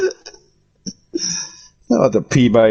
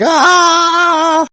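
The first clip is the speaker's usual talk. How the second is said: mock horror-movie shriek, high and loud; imitated scream; 5 dB louder than usual. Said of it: "It's like yelling, top of your lungs".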